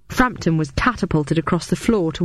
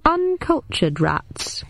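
dry joke; 10 semitones higher, much slower than usual. Acted. Dry joke, slow high.

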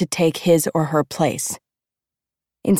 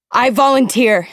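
urgent, higher-pitched cry; gasped volunteering; 6 semitones higher, 4 dB louder than usual. A narrator's reading. Sister rushing to take her sibling's place, gasped urgently. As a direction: call it out in urgent desperation. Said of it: "I gasp".

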